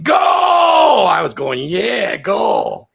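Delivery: loud mock shout of goal; mock stadium cheer. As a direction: yell it like a crowd at the match. Acting out cheering at game.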